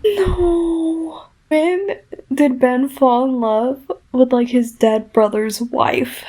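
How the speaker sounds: upset tearful protest; anguished cry. Real, not acted. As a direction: wail it like betrayed by the book. Shocked by plot twist.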